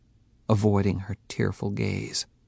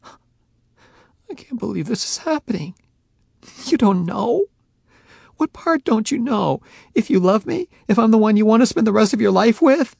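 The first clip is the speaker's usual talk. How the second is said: high, building louder, upset; distraught; 13 semitones higher, 7 dB louder than usual. A narrator's reading. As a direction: pour out disbelief and hurt. Faye distraught.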